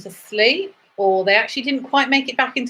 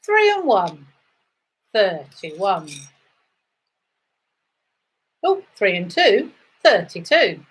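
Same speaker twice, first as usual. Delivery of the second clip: sing-song number calling, rising then falling; bingo caller; slower than usual. Acted. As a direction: call each number out to the hall.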